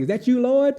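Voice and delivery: high, hushed wonder at the light; awed question. Retold or imitated. To the light after fainting, a high awed question. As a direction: ask like you're seeing heaven.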